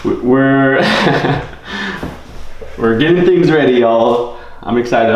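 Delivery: pumped to start the live; excited. Real, not acted.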